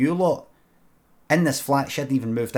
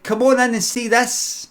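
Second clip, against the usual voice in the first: higher, louder eager call; excited invitation; 8 semitones higher, 5 dB louder than usual. Retold or imitated. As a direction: call the neighbours in to look. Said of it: "She said".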